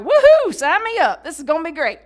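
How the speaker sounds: very high, loud whoop settling into eager chatter; gleeful whoop. Retold or imitated. Rider thrilled over her new horse, loud high whoop. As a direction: cheer like a kid given a prize. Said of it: "And I'm like".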